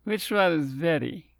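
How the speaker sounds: slurred, tipsy question, words running together; drunk slurring. Acted. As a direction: ask it like a man a few shots in. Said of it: "asked while slurring his words".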